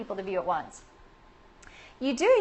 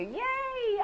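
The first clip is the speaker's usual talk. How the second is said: cheering; 13 semitones higher, 4 dB quieter than usual. Real, not acted. Host celebrating a viewer's introduction, high cheer. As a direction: let out a high, drawn-out little cheer.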